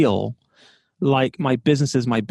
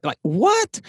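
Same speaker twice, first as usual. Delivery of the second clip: incredulous, pitched way up; disbelief; 10 semitones higher than usual. Retold or imitated. Overwhelmed owner reacting to bad news, incredulous. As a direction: throw up the question in shock. Landlord notice, receptionist quits.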